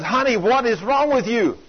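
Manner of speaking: high, urgent cry of alarm to his wife; frantic plea. Retold or imitated. Husband pulls wife off bridge.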